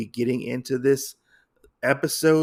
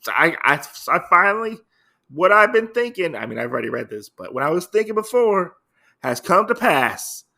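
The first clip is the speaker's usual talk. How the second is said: high, loud, tumbling words of triumph; excited gloating; 5 semitones higher, 5 dB louder than usual. Real, not acted. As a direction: crow that you called it. Host gloats his guess came true.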